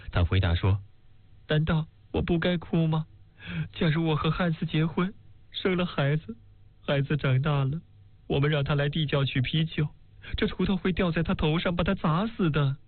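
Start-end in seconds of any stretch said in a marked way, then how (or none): 1.5-12.8 higher voice, mild mock tearful lament; Elsie lamenting. A narrator's reading. Elsie answers the maid, a lightly voiced tearful lament. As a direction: plead that crying is only sensible, pitched higher.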